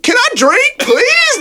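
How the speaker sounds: high, whiny, pleading please; exasperated plea. Retold or imitated. Begging the strangers at the bar to let him drink, exasperated and high. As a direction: beg to be left alone with your drink, voice climbing.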